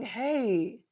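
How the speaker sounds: high, light curious asking; a lightly voiced gentle therapist asking; gentle therapist question. Shown as a demonstration. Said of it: "it's saying".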